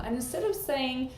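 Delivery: ordinary delivery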